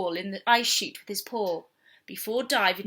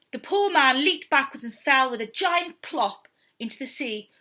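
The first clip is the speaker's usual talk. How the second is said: ordinary delivery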